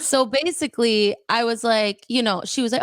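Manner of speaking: ordinary delivery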